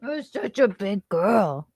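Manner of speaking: slow, lightly cooing praise; mild doggy-talk praise; pet talk. Real, not acted. Praising the dog.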